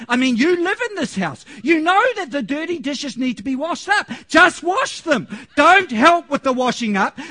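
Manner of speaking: loud, high ranting tirade, peaking on the commands; wife shouting at her husband over the dishes; furious wife. Retold or imitated. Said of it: "And she said it angrily".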